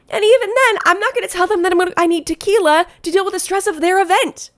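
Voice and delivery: real worked-up, high-pitched outburst; getting loud. Real, not acted. Planner indignant about oversharing with a client, getting loud. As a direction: get carried away, pitch shooting up. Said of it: "Sorry, I'm getting loud".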